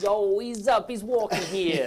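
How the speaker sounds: put-on hammy Boston street accent. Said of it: "Could you have, like, a Boston accent?".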